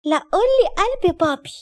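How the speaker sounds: put-on high, slow little-girl pleading. A narrator's reading. Small daughter asking daddy for a pet name, childlike. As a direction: playfully demand it like a small child.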